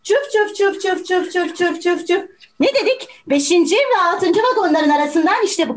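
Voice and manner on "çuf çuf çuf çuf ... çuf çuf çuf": steady rhythmic chuff-chuff, slightly raised pitch; imitated tram chugging. Juror shows how the tram drowns out voices, chugging. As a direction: keep the train noise chugging along. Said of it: "Benim sesimi bile zor duyuyorsunuz".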